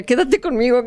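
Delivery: begging someone to stay; pleading. Retold or imitated. Frightened bride begging the servant to stay, mild plea. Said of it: "porque él decía".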